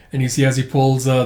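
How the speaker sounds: plain reading voice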